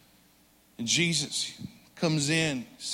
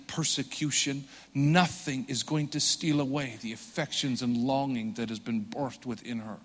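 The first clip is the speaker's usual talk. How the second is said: hushed, tender sermon voice, softer; soft intimate preaching; 9 semitones lower, 13 dB quieter than usual. Acted. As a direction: draw them in quietly, like sharing a secret.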